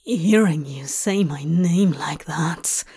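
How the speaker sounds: low whispered murmur in the ear. Acted. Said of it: "Crowley murmured in his ear".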